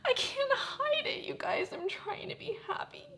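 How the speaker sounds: high tearful voice breaking into whisper; tearful, words squeezed out high; trying not to cry. Real, not acted. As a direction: choke back real tears as you talk. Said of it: "I'm trying not to cry".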